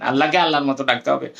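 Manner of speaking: ordinary talk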